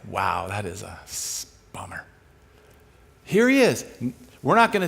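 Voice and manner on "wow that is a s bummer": low, soft, trailing away; retold deflated reaction. Speaker reacts to the student's problem, quietly deflated. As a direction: let it sink under your breath.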